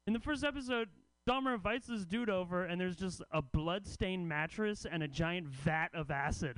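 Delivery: normal talking voice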